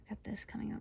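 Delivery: soft whisper. Real, not acted. Reader to viewers, whispering. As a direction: breathe it as a secret.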